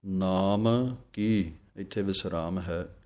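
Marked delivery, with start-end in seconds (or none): 0.1-1.5 ਨਾਮ ਕੀ ਬਹੁਤ ਹੌਲੀ, ਵੱਖ ਵੱਖ; ਨਾਮ, ਫਿਰ ਕੀ, ਧਿਆਨ ਨਾਲ ਵੱਖਰਾ; slow, separate. Shown as a demonstration. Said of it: "ਵੱਖਰਾ ਵੱਖਰਾ ਬੋਲਣਾ ਹੈ". (ਹੌਲੀ ਵੱਖਰਾ ਉਚਾਰਨ)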